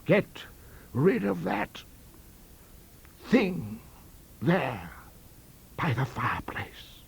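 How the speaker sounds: high, halting, sinking toward a whisper; horrified order. A narrator's reading. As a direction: force it out haltingly, appalled. Terrified man orders the apparition removed.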